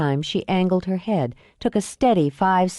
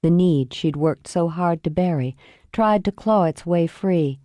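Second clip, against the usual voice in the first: plain reading voice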